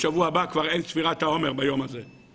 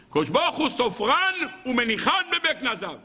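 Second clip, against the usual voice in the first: higher, ringing declamation of a quote; preaching declamation; 8 semitones higher than usual. Real, not acted. Rabbi hammering home a sacred quote, declaiming. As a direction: lift the pitch and proclaim each phrase.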